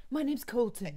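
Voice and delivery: Colton voice. Pretend. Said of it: "we'll reenact it".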